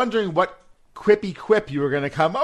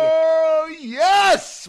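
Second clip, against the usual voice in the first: excited cheer; 12 semitones higher than usual. Real, not acted. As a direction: cheer for the next singer. Cheering the announced singer.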